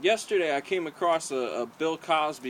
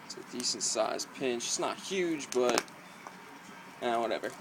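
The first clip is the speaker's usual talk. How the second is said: talking around a dip lip; lip full of dip; 5 dB quieter than usual. Real, not acted. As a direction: speak around a wad of tobacco. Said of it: "throw a lip in".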